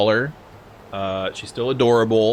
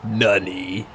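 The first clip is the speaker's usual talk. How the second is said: echoing the dub's nani; mock anime dub. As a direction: parrot the dub line. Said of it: "Fucking nani?".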